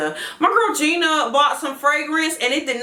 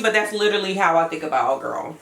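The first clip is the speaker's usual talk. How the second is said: normal talking voice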